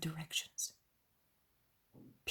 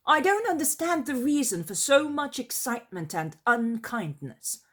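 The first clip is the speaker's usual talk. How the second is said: high, loud, swooping protest; Iscariot objecting; 6 semitones higher, 7 dB louder than usual. Acted. The Iscariot questions the others' excitement and unkindness, lofty protest. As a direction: protest loftily with the pitch leaping.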